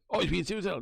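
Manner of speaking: high mock voice of police; a mocking high imitation of the police. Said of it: "they took it as".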